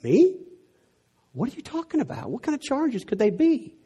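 retold shock at the accusation; shocked disbelief. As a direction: react as if blindsided. Retelling his shocked reply.

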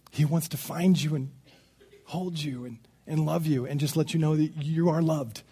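soft, gentle, hushed; tender assurance. Real, not acted. Preacher assures listeners they are loved, softly and tenderly. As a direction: drop right down and reassure gently.

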